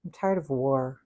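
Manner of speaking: usual speaking voice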